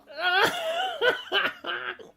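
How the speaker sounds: shrieking with laughter; helpless high giggle; high squealing laugh. Real, not acted. Laughs at the comment.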